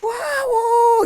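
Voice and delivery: very high squealing awe; crowd awe. Retold or imitated. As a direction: cry out like a thrilled crowd. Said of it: "everyone's like".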